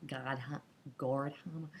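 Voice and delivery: attempted American accent on "Gordhammer"; American-accented try at the surname "Gordhammer". Real, not acted. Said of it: "tried to do that in an American accent".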